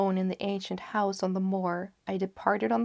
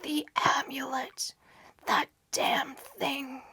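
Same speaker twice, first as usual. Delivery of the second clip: strained, higher-pitched whisper of a dying man; 6 semitones higher, slower than usual. A narrator's reading. Dying friend to narrator after being torn apart, gasped whisper. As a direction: whisper it with failing breath, drawing out the last words. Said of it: "All he could do was to whisper".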